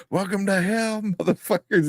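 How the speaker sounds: high, laughing quote; meme impression. Host quoting a roller coaster meme, high and amused. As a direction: imitate the meme line through laughter. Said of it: "turns around and goes".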